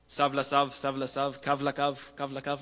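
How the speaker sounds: lightly chanted nonsense syllables; mock baby talk. Shown as a demonstration. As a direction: reel off even, repetitive baby babble. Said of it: "that kind of baby talk".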